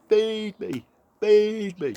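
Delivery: very high, loud chick cries; shrill little chicks crying for food; baby birds begging. A narrator's reading. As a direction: squeak it out like hungry baby birds. Baby birds, kids invited to join.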